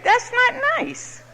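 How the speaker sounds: high, slow prissy tut-tut; mock prim scolding. Speaker mocking herself for leaving a free drink, high slow prim scolding. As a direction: scold like a prim lady, drawn out. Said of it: "mock scolding aside".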